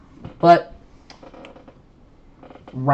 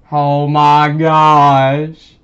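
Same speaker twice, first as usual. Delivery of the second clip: drawn-out surprise; 10 dB louder, much slower than usual. Real, not acted. Discovers login wasn't needed.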